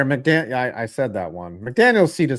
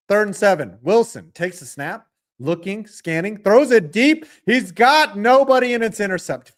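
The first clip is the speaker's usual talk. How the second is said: louder, higher sportscaster call; play-by-play call; 7 semitones higher, 6 dB louder than usual. Acted. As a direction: call the drive like a TV announcer. Calls the game play-by-play.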